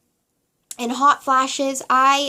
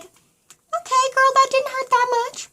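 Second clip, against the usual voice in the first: loopy drugged voice; 14 semitones higher than usual. Retold or imitated. Mom-to-be on morphine brushing off the shot, in a high loopy voice. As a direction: play yourself tipsy on morphine, squeaking it out.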